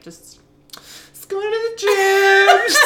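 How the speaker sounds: very high, drawn-out loud squeal; stammer, then squeal your excuse slowly; awkward squeal. Retold or imitated. Cornered man making small talk with ignored date, awkward squeal.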